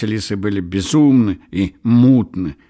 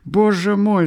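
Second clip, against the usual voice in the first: high, loud mortified cry; shrieked exclamation; horrified outcry; 13 semitones higher, 5 dB louder than usual. A narrator's reading. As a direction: cry out in embarrassed alarm, pitch way up. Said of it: "вскричал багровый Лариосик".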